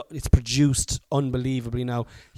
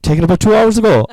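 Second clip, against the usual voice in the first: loud laughing echo; 9 semitones higher, 14 dB louder than usual. Real, not acted. Joking echo of partner.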